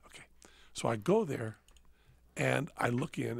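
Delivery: ordinary talk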